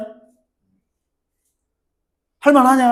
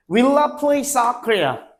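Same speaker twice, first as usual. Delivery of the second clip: slow dictation; much slower than usual. Shown as a demonstration. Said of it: "dictating the main clause".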